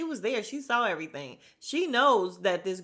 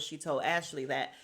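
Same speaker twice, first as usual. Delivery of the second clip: ordinary delivery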